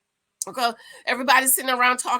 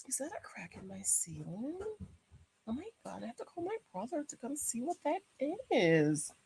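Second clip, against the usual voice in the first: very soft, near-whispered worry to oneself; hushed aside; 13 dB quieter than usual. Real, not acted. Host spots a crack in the ceiling, hushed aside. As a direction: drop out of the show into a murmur.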